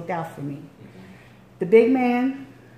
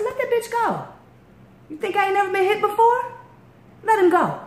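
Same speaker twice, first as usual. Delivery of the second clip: high, loud defiant yell; defiant shout; 13 semitones higher, 3 dB louder than usual. Retold or imitated. Woman daring the held-back man, high defiant shout. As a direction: dare him to come at you.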